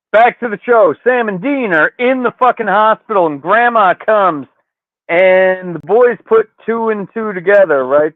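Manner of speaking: usual speaking voice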